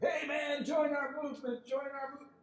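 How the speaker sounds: high, shouted street-activist call; mock the eager protesters hailing a passerby; excited protesters. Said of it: "they're like".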